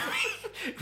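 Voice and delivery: high unvoiced giggle; giggling breathlessly at the memory. Real, not acted. Giggling at the dodgy cake handover. Laughs at the car park deal.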